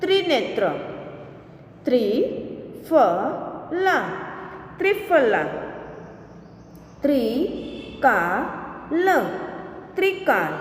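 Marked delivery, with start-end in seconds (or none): 1.9-4.0 slow, each sound drawn out, rising at the end; slow drawn-out reading. Shown as a demonstration. Teacher slowly sounding out a word for students, drawn out. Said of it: "के उदाहरण हम देखें". 7.0-9.2 slow, each sound drawn out, rising at the end; slow drawn-out reading. Shown as a demonstration. Teacher slowly sounding out a word for students, drawn out. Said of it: "के उदाहरण हम देखें".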